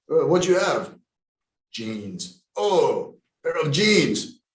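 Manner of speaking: hammed-up Russian accent, swooping in pitch; mock Russian marveling at the goods. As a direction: play a Russian buyer, put-on accent. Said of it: "That was a great Russian accent".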